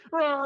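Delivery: loud high war roar; battle cry. Retold or imitated. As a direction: let out a raging yell. Barbarian rages at mimic.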